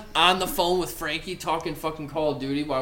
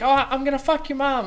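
mock little-kid voice, pitched way up; 11 semitones higher than usual. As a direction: imitate a mouthy little kid online. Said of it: "little kids that'll be like".

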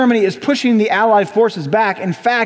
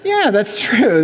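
mooed agreement; slower than usual. Acted. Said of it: "I think it's an agreement".

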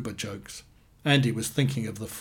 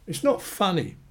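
higher-pitched, falling rebuke; mildly indignant, pitched up; indignant protest; 7 semitones higher than usual. A narrator's reading. Annie to Amanda, it's not a joke, indignant.

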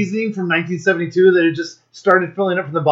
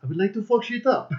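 hushed, higher mock-Chechen accent; muddled Chechen accent; 4 semitones higher, 10 dB quieter than usual. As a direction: play a soft-spoken strongman in a botched Chechen accent. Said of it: "Why am I even going Haji on that accent".

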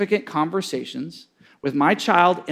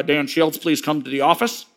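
loudspeaker announcement; 6 semitones lower than usual. Retold or imitated. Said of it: "voice that comes over the loudspeaker".

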